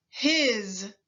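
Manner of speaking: "his." ending in a voiced z; teacher stretching the final z; final buzz. Shown as a demonstration. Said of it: "Repeat after me".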